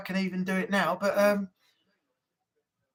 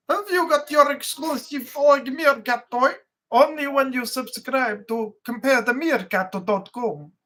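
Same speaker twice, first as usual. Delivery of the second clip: slow, higher Romanian-style meerkat accent; Sergei meerkat; 3 semitones higher, slower than usual. Shown as a demonstration. Meerkat pitching an exclusive toy to subscribers, mock Romanian accent. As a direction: draw it out in a hammy Romanian accent. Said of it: "I think it's Sergei, isn't it?".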